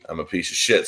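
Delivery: usual speaking voice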